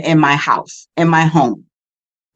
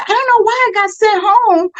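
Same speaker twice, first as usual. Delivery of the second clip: husband's puzzled voice; 14 semitones higher than usual. Retold or imitated. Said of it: "he was like".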